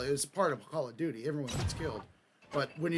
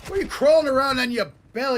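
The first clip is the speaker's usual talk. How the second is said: high, loud exasperated shout; shouted taunt, pitched way up; real loud yell; 12 semitones higher, 15 dB louder than usual. Real, not acted. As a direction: yell it at the enemy player. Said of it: "I'm too loud".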